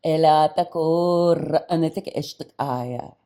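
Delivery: ringing ritual chant of strange syllables; channelled light language. Real, not acted. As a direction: intone it forcefully like an invocation. Channels an unknown spirit language aloud.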